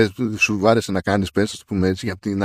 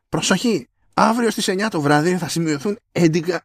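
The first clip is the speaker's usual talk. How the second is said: higher, mock-official alert read; reading an alert email; 6 semitones higher than usual. Host reading the store's weather warning email to his cohost, mock-official. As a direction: announce it like a pompous warning. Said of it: "άκου εδώ mail που ήρθε".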